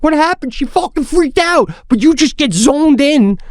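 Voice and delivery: very high, worked-up exclaiming; retold heated outburst. Dad reports daughter's freakout to wife, acted-out high agitation.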